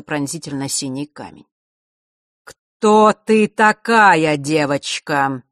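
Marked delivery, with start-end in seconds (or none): 2.4-5.4 медленный грозный старик, строго; спроси строго и грозно; строгий вопрос. A narrator's reading. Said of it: "строго спросил грозный старик". (строгий грозный старик)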